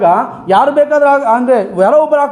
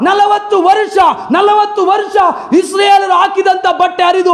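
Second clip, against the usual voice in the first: raised, high-pitched preacher's cry; fiery preaching; 6 semitones higher than usual. Acted. Preacher rallying the congregation against fear, fiery preaching. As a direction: preach it out full force to the hall.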